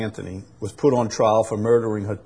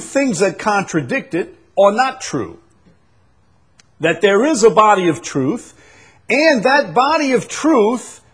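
high, raised, insistent sermon voice; full-force preaching; 9 semitones higher, 5 dB louder than usual. Real, not acted. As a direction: hammer the point home to the congregation.

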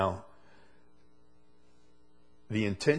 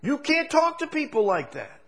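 full-force preaching. Acted. Preacher to congregation on rude bosses, full-force preaching. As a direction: preach it out to the congregation, pitch raised.

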